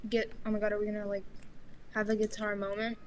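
ordinary delivery

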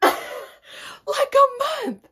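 laughing, high, loud drawn-out outburst; incredulous shriek. Real, not acted. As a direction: shriek it in disbelief, stretched out. Repeats it in disbelief.

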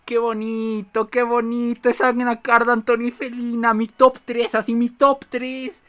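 doting on favourite neighbours; tender gushing. Real, not acted. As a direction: coo over something adorable. Gushing over favourite villagers.

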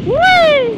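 high, loud gleeful whee; a happy high cry of joy; joyful whee. Real, not acted. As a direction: squeal with delight on the bike. Riding joyfully.

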